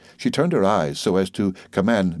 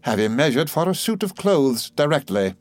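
brightened; 6 semitones higher than usual. A narrator's reading. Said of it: "brightened, and rejoined".

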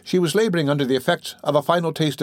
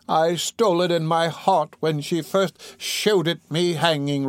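put-on slow, higher-pitched old woman confessing; dying woman, remorseful; 6 semitones higher, slower than usual. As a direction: draw it out, heavy with guilt.

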